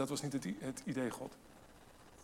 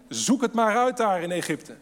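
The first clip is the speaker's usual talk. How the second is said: sarcastic; 7 semitones higher, 9 dB louder than usual. Retold or imitated. Preacher voices God sarcastically.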